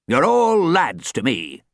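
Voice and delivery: put-on slow, firm old coach retort. A narrator's reading. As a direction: set her straight, slowly. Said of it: "he retorted".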